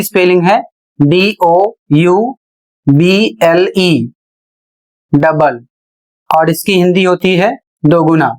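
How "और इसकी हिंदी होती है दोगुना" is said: ordinary talk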